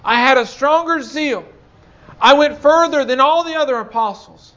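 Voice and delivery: loud, high preaching cry; preaching at full force. Acted. Preacher booming Paul's boast to the church. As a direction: proclaim it to the congregation.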